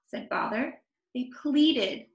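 ordinary delivery

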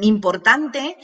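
usual speaking voice

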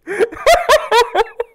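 loud, high squealing laughter; helpless high laugh. Real, not acted. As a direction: lose it completely. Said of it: "I'm, I'm crying".

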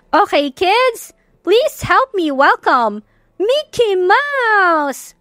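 mataas at mabagal na pagtawag ng host; sigaw ng host. A narrator's reading. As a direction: ianunsyo nang mahaba at masigla sa entablado. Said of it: "sigaw ni Miss Paloma". (host na tumatawag sa mga bata, mataas at mabagal)

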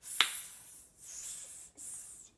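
"S" as a long voiceless "sss", not "ess"; phonics S sound. Shown as a demonstration. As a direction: hiss out "S, s, s" like a snake, separately.

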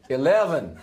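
badly faked American accent; attempted American accent. Scotsman trying American for a voice-activated lift, hammed-up bad American accent. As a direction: put on a dodgy American twang that lands nearer Irish. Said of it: "You need to try an American accent".

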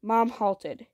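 plain reading voice

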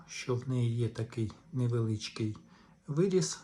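ordinary delivery

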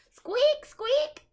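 high squeaky little mouse cry; mouse squeaking. Acted. Peanut the mouse yelling at the monkey, high squeaks. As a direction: squeak it out like a tiny pet mouse.

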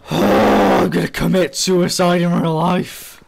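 hyperbolic, drawn-out frustration; exasperated outburst. Real, not acted. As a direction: groan the whole line out in despair. Hyperbolic outburst after losing items.

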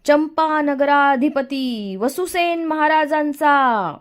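high, slow, stretched-out herald's cry; reverent royal proclamation. A narrator's reading. Bowing minister hailing the new play king, ceremonial proclamation. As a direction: proclaim the king with long drawn syllables. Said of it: "अत्यंत आदरानं वाकत ब्रह्मदत्त म्हणाला".

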